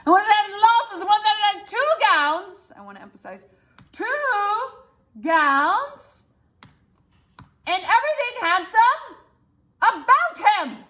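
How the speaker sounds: rising high, building to the end; boastful crescendo. Shown as a demonstration. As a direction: build up to about him. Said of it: "That is my crescendo".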